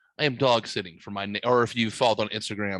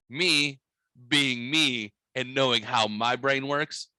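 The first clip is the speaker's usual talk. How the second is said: usual speaking voice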